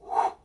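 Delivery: soft whispered whew of release; relieved exhale. Retold or imitated. Tension leaving the dad, a soft whispered whew. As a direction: let the air go out. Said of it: "the energy just went".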